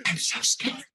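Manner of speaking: high, mock-frightened whimper; mock scared doctor. Doctor watching the colonoscopy, in mock fright. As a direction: pretend the doctor is quaking. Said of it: "the doctor just sat there going".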